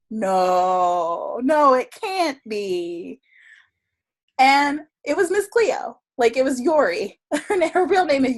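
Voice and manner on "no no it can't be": slow, drawn-out retold disbelief; acted disbelief. Tarot reader recognizing a celebrity client, acted disbelief. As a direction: stretch each no in dawning recognition.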